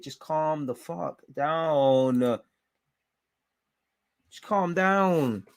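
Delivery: very slow and stretched, the final down held long; drawn-out exasperated plea. Real, not acted. Fan pleading with his frustrated team to settle, slow and stretched. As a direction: drag every word out in exasperation. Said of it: "We're getting too frustrated".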